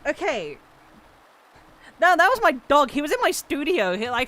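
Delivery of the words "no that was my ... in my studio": excited realization. Real, not acted. Realizing dog sneezed.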